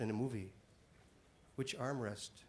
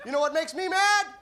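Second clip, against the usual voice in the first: high, loud shout with a laugh; comic setting up the crowd call; 8 semitones higher, 8 dB louder than usual. Shown as a demonstration. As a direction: bark out the question. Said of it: "let's have some fun with this".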